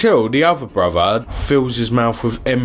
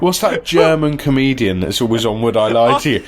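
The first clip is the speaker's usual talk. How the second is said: ordinary delivery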